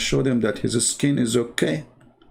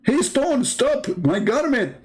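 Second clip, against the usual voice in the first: much higher, slower mock call to the stone; mock Moses calling; 11 semitones higher, slower than usual. Moses shouting after the stone, hammed-up high call. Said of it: "Moses talking to the stone".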